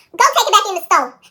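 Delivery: very loud, high, quick command; mother's angry order. Retold or imitated. Mom sends child back to store, shouting. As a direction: imitate mom yelling an order.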